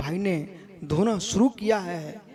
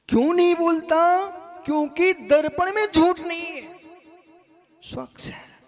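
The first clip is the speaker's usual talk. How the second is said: booming political rally speech; 10 semitones higher, 3 dB louder than usual. Acted. Preacher booming to crowd.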